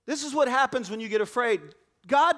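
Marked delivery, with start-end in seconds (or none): 2.1-2.3 loud, high, desperate plea to God; panicked cry. Acted. Frightened disciples crying out in the storm, loud and high.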